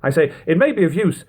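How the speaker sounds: measured, even book-reading voice; reading aloud. Acted. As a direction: read the old text steadily, like an audiobook. Reads a historical book aloud.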